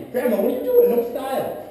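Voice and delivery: high, loud comic exasperation; mock scolding. Grandson teasing his grandma about Afghans as shoes, mock scolding.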